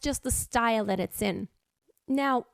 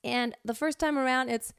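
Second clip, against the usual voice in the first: ordinary talk, nothing marked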